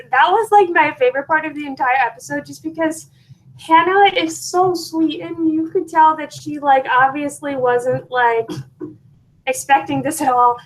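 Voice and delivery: ordinary talk, nothing marked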